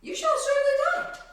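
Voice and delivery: high, loud voice of doom; exclaimed high and loud. Acted. Repeats pronouncement with raised voice.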